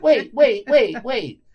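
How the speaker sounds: laughing, high and loud. Real, not acted. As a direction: blurt it out through laughter. Laughing while interrupting.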